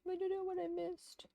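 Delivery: quiet muttering. Real, not acted. Quietly reading along and muttering.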